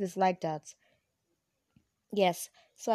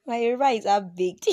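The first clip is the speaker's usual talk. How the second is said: laughing; 5 semitones higher, 7 dB louder, faster than usual. Real, not acted. Laughs about her weak Yoruba.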